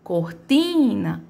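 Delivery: Cortina with a slow, drawn-out TI; stress on TI. Shown as a demonstration. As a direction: linger on "ti" in Cortina. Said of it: "é o meu ti". (Cortina com o TI arrastado)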